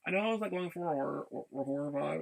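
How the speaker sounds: normal talking voice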